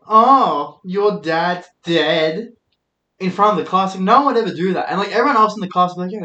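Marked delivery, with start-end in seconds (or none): 0.1-2.5 mocking popular kid. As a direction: drag out the taunt like a mean classmate. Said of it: "the popular kids are just like".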